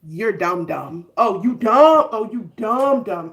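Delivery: teasing you-dumb chant; imitating a contemptuous friend; mock scolding. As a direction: repeat it like a scornful taunt. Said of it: "she was just like".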